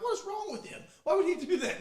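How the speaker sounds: high, incredulous mock outrage; mock fuming. Angry left fielder ranting about the pitcher, high mock outrage. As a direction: vent at the pitcher in disbelief. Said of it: "I'm like".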